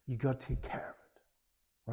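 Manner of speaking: hushed, almost whispered dad's counsel; fatherly advice. Acted. Dad in a chat with his seventeen-year-old lad, hushed fatherly advice. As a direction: drop to a near whisper, advising a son. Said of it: "where their dad says".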